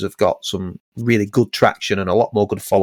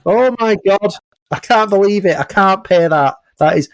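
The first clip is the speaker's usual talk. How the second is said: mock shocked client; 8 semitones higher than usual. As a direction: play the client reeling at the fee.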